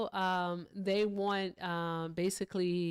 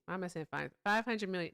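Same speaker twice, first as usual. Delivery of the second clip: usual speaking voice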